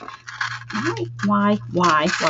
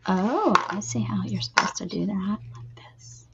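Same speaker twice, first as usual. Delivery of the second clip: hushed whisper to herself; 3 semitones lower, 5 dB quieter than usual. Real, not acted. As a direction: whisper it, barely voiced. Whispering while working on the craft.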